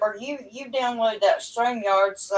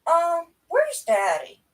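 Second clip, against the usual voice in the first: slow, higher, innocent little ask for Daddy; imitating daughter; 7 semitones higher, slower than usual. Mom retelling daughter's call, slow higher innocent ask. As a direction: mimic the daughter sweetly asking for Dad. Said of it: "next words out of her mouth".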